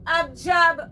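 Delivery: high, loud, love-struck calling out; swooning lady. Pretend. Lovesick woman calling for her Indian prince. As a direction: pine for the prince and call his name.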